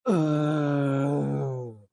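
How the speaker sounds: loud, drawn-out fed-up groan; big exasperated ugh; collective groan. Retold or imitated. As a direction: groan like a whole cabin giving up. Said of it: "the entire plane just goes".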